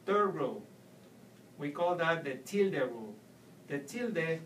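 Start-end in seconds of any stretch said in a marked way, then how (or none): none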